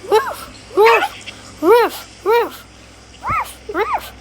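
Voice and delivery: high yappy puppy barks; dog barking. Pretend. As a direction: bark like an excited puppy.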